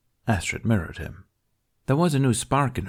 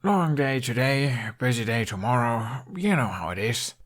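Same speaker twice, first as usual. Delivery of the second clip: fake weary excuse; 3 semitones higher than usual. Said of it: "Hiccup yawned again for emphasis".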